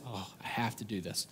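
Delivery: reluctant low moan of duty; grudging groan. Retold or imitated. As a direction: sigh it out like a chore you dread. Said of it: "we come at it in our tone".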